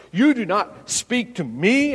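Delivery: a mock outburst from Pilate, not full force; blurted out. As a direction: bark it like an offended governor. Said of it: "He blurted out".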